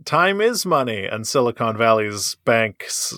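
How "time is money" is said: ordinary delivery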